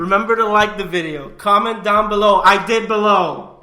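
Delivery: loud, slow hammed-up host call-out; loud announcer outro. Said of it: "one more time".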